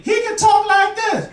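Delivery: very high, slow exaggerated demo voice. Shown as a demonstration. Showing the ladies how the man can sound. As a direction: squeak it out slowly for the contrast.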